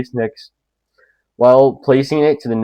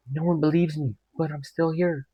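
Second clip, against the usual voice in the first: put-on higher, much softer ghost-girl murmur; ghostly voice; 5 semitones higher, 10 dB quieter than usual. Ghost girl behind the door, in a soft high mock ghostly murmur. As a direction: croon it like a lost little ghost. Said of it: "And she's".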